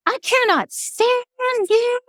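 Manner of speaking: high, slow, sugary Southern lady telling you off; mock-sweet Southern put-down, high and drawn out. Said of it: "Ladies in the South would be angry and mad at me".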